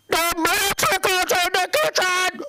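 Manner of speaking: put-on high, slow outraged old man; hammed-up shrill complaint, drawn out; father-in-law voice. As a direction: wail it like an upset Filipino dad. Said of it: "I'm gonna do the voice".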